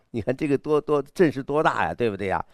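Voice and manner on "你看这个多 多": usual speaking voice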